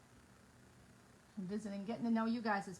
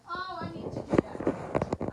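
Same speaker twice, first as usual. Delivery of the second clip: excited realization; 7 semitones higher, 8 dB louder, much slower than usual. Retold or imitated. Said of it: "I was like".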